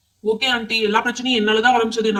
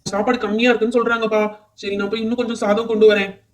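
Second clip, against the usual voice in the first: normal talking voice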